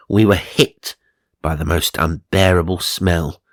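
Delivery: ordinary delivery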